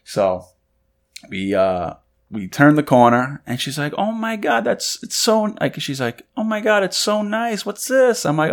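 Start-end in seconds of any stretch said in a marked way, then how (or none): 4.0-8.3 high, softly gushing fiancee voice; mock delighted surprise; imitated surprise. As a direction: play her amazed reaction, pitched well up.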